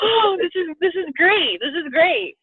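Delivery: high, emotional delight; tearful joy. Retold or imitated. Telling her coach how moved she was, high and tearful. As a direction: near tears with happiness. Retold joyful reaction, crying heard.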